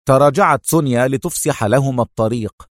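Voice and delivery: usual speaking voice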